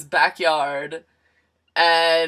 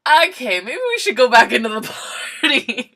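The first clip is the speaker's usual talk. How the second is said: awkward escape; 5 semitones higher, 6 dB louder, faster than usual. Retold or imitated.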